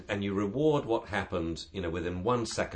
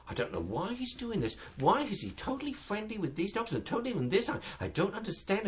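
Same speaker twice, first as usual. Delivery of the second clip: high, bewildered owner voice; confused owner; 12 semitones higher than usual. Retold or imitated. Puzzled owner to trainer, high and helpless. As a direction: play the baffled dog owner, pitched up. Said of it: "the owner will say".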